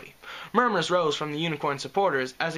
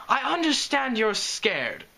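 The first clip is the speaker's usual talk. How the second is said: slow, higher, trembling calm; shaking but measured; 9 semitones higher, slower than usual. Acted. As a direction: hold the fear down, slowly. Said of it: "in a shaking but measured voice".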